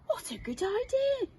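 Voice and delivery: high eager Zog voice; grateful little dragon; eager young dragon. Acted. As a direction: brighten up at the idea. Zog answering the girl.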